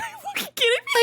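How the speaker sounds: high, fast, laughing disbelief; wife teasing husband about what made him cry; incredulous. Retold or imitated. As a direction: squeal it in playful shock.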